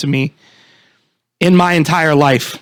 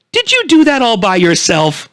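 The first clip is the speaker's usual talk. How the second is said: sweet talking-down voice, pitched up; condescending lady; 6 semitones higher than usual. Retold or imitated. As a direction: speak like to a small child. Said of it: "That was the tone".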